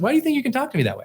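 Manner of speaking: rushed retelling of wife. Retold or imitated. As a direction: rattle off her words quickly. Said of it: "she just said".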